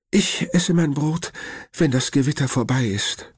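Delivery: high, lightly voiced worried grandmother; grandma's voice. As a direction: speak up high as the fussing grandma. (hohe, besorgte Oma-Stimme)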